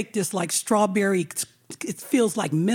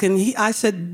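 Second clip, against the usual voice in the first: ordinary talk, nothing marked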